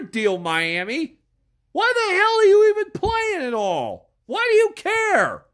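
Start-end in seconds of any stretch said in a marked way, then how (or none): 1.7-5.4 incredulous yelling. Real, not acted. Yelling at Miami in a rant.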